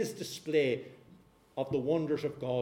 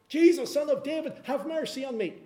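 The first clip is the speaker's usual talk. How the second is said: high, loud cry of Jesus, son of David; mock yell; 10 semitones higher, 6 dB louder than usual. Blind beggar shouting to Jesus over the crowd, high loud plea. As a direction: call out over the crowd, pleading. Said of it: "And he yells it out".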